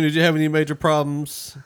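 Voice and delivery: ordinary talk, nothing marked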